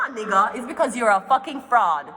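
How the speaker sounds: ordinary talk, nothing marked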